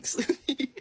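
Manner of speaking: a laughing jab at the name; laughing taunt. Real, not acted. Taunting the stalker with her nickname, laughing. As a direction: laugh it out as a sneer.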